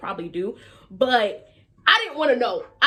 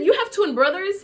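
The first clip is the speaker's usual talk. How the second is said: higher, louder surprised question; retold astonishment, pitched up; 9 semitones higher, 6 dB louder than usual. Teller quotes her reaction to the twin news, surprised.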